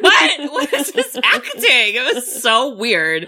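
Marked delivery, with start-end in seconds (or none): 0.0-1.9 laughing in disbelief; incredulous. Retold or imitated. Friend reacting to bad acting, high and laughing. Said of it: "And I was like".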